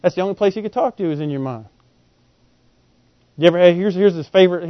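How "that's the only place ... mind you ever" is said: ordinary delivery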